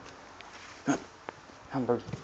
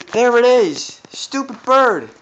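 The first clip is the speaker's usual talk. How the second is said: loud, high frustrated shout; frustrated yelling; 10 semitones higher, 9 dB louder than usual. Real, not acted. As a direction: yell at the stubborn bird. Bird spotted, frustrated yelling.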